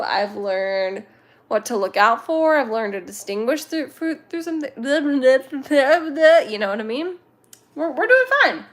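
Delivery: normal talking voice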